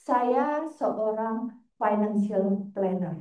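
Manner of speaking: very slow, measured self-introduction; deliberate announcement. Retold or imitated. Visitor baffling the guard with a job title, slow and deliberate. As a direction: spell out the job title slowly.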